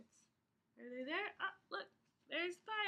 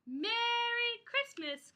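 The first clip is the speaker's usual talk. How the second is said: high, slow cheerful mouse call; 7 semitones higher, slower than usual. Acted. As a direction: call out sweetly like a tiny mouse. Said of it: "called the mouse".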